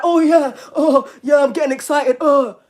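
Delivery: hammed-up aroused groaning; mock excited pervert. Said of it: "People are watching me like".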